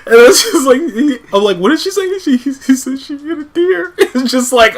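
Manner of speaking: high-pitched, laughing through the words; cracking up. Real, not acted. Retelling the deer call to friends, cracking up. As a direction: struggle to talk through real laughter.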